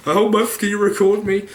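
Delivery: squeaky put-on ask for a favour; mock pleading. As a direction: him putting on a voice to beg a stranger at the gym to film him. Asking stranger to film him.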